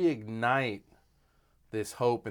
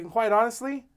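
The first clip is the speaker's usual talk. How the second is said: quoting director; 10 semitones higher, faster than usual. Retold or imitated. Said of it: "And he's like".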